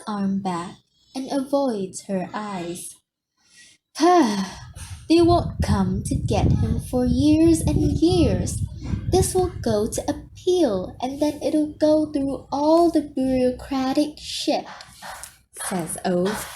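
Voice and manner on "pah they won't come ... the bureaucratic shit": louder, insisting he's sure; forced confidence. A narrator's reading. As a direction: bluster to hide doubt. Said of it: "He tries to sound more convinced".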